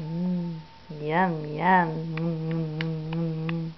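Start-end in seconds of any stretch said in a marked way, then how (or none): none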